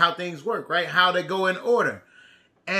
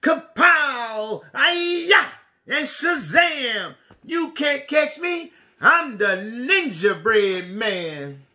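ninja battle shout; 2 semitones higher, much slower than usual. Acted. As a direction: shout kung-fu cries and tease the chasers.

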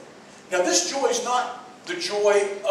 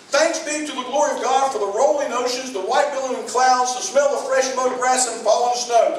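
raised, lifted praise for creation; read-out prayer of praise; 4 semitones higher, 3 dB louder than usual. Shown as a demonstration. Preacher reads an example praise prayer, lifted and sincere. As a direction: lift up thanks for the oceans and clouds. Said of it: "Don't you think that's praises?".

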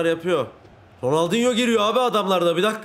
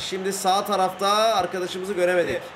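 excited commentary climbing higher as the attack builds; live play-by-play; 6 semitones higher than usual. Pretend. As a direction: call the attack like a match commentator, rising. (heyecanı yükselen maç spikeri)

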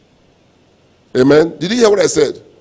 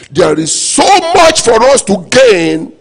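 preaching exhortation; 6 semitones higher, 4 dB louder than usual. Acted.

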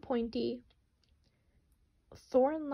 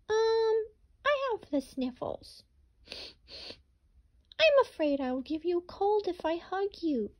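much higher, hesitant bunny voice; high apologetic rabbit backing away from Hedgehog; sniffly rabbit; 9 semitones higher than usual. Acted. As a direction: squeak it out like a timid sick rabbit.

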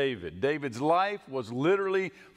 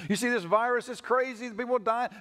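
higher, hammed-up worried complaining; mock fretting; 8 semitones higher than usual. Shown as a demonstration. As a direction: list the bad news in a higher fretting voice. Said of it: "We tack that on".

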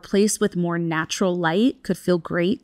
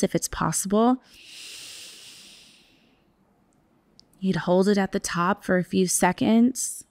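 guided meditation. Acted. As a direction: ease listeners into stillness. Counts a breath hold.

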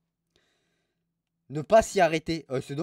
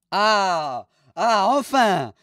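triumphant cry; 3 semitones higher, 8 dB louder, slower than usual. Real, not acted. Streamer gloats at reviews.

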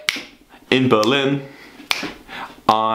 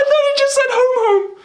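laughing. Real, not acted. Admitting his misreading to chat, laughing. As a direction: crack up while saying it.

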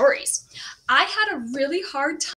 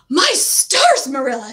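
exclaiming; 9 dB louder than usual. A narrator's reading. Gossipy neighbor startled by the girl, exclaiming. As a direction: gasp it out in shock.

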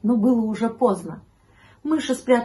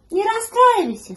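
very high, loud kitten voice; high-pitched little cat; 13 semitones higher, 7 dB louder than usual. A narrator's reading. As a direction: pipe up as a consoling kitten. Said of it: "сказал котёнок".

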